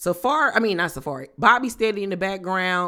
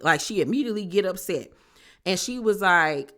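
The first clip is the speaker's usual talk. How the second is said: usual speaking voice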